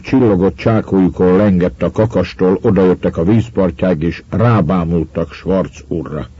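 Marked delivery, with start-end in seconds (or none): none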